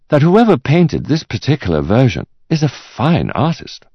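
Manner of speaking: high, lilting appraiser's voice; appreciative art expert. Acted. Expert continues his verdict.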